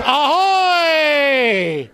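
"Ahoy" drawn out long and shouted; "Ahoy" hollered across the deck, stretched out; long pirate hail. Acted. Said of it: "Talk Like a Pirate Day".

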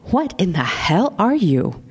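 slow, deliberate question with some Boston; a hint of Boston, said slowly and clearly; toned-down Boston accent, drawn out; lighter Boston accent. A narrator's reading. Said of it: "dropped most of it to continue more clearly".

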